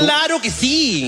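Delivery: high, drawn-out exclamation; big, slow, high-pitched yes; emphatic agreement. Real, not acted. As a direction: agree wholeheartedly, stretching the words slowly. Exaggerated agreement with the joke.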